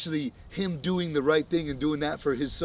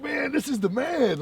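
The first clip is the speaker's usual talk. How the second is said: amazed crowd; 13 semitones higher, 3 dB louder, slower than usual. Retold or imitated.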